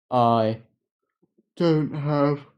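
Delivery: talking through a yawn. Real, not acted. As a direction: yawn while speaking. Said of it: "I'm sorry that I'm yawning".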